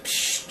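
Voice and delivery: hissed "psh" of spraying liquid; sharp spraying sound effect; spurting noise. Shown as a demonstration. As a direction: imitate a sudden squirt. Imitates blood spurting out.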